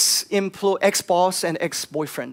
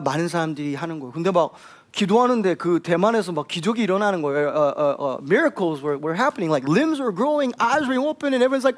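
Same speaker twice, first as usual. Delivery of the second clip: normal talking voice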